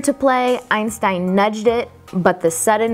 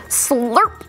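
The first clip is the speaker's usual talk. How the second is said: higher, sudden playful slurp; slurping sound word; 4 semitones higher than usual. A narrator's reading. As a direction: act out a big sloppy dog lick. Narrator imitates the dog's big lick.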